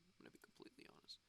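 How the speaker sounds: fast, whispered confession; whispered aside. Real, not acted. Whispered aside about a name.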